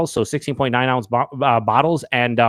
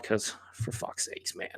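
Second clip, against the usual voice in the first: exasperated whisper; 3 semitones lower, 17 dB quieter, slower than usual. Real, not acted. Frustrated with a disappointing beer.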